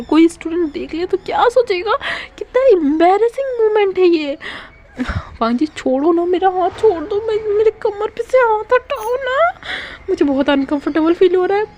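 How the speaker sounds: high, pleading embarrassed voice; flustered Wing. Acted. Wing pleads with Wang Ji to let go, high and flustered. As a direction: beg him to let go, squirming and high. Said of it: "wing उसको काफ़ी धीरे धीरे बोल रहा था".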